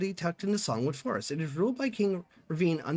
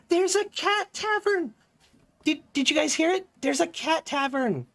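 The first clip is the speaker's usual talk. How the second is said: real excitement; 10 semitones higher, 3 dB louder than usual. Real, not acted. Player tells viewers about a cat tavern, thrilled. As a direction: share a thrilling discovery, pitch way up.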